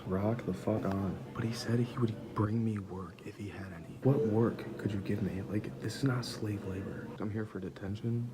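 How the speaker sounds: quietly grumbling under your breath; hushed. Real, not acted. A student serving detention in the dean's office, hushed complaining.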